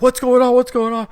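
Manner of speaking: high-pitched mock panic; hammed-up frantic questioning; freaking out. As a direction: imitate a panicked checkout guy, voice shooting up. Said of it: "he's, like, freaking out".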